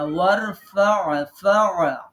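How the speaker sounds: ওয়ারফা' slow, then ফা' alone, ain pressed from mid-throat. Shown as a demonstration. Teacher isolates the ain for students who miss it. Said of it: "আইন কণ্ঠের মাঝখানে".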